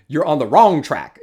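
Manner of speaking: laughing correction, stressing wrong. Real, not acted. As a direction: correct yourself, hitting wrong. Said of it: "I'm sorry".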